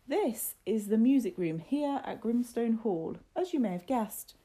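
put-on emphatic announcement to a group of children; Missus Fox, guiding. A narrator's reading. Voices Missus Fox as guide.

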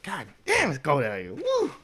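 high yelp about the cold, ending in a shrieked woo; cold grandma. Retold or imitated. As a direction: yelp like grandma hit by the freezing wind. Grandma out in the snow.

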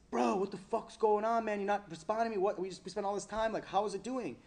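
high-pitched, agitated demand for answers; exasperated scolding. Retold or imitated. Teddy calling the man who overslept, exasperated. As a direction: imitate a fed-up mentor demanding news. Acted angry call from Teddy.

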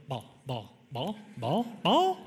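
lightly voiced dog, rising to a squeak; frantic dog. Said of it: "They just go".

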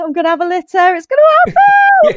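voiced dog going from fretful to shrieking; mock panicked dog mother. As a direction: ham up a frantic dog about to give birth. Voicing pregnant dog, rising panic.

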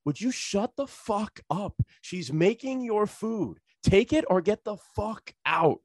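high, slow, emphatic scolding; exasperated telling-off. Retold or imitated. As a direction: spell it out slowly to a troublemaker. Retold man tells off the customer.

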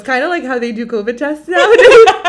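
laughing through the words; loud, laughing giggle. Real, not acted. Laughing while telling the story.

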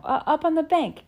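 high, loud eager answer; excited. Acted. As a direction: answer in a rush.

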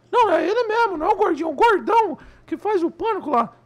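falsete agudo e alto, insistindo; vozinha de comediante. Acted. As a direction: vozinha fina projetada. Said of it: "uma vozinha assim". (corrigir o outro em voz bem aguda)

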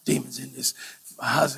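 hushed whisper into the dark; frightened whisper under the covers; whispered prayer. Retold or imitated. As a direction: breathe the words out, barely audible. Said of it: "He's like".

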